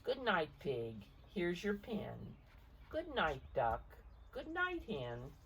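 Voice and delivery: gentle read-aloud picture book voice; story narration. A narrator's reading. Parent reading the storm page aloud to a child, gently.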